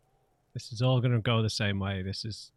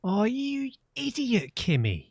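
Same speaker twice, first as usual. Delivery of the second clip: mock exasperation; 12 semitones higher, slower than usual. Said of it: "annoyed at Kim butting in".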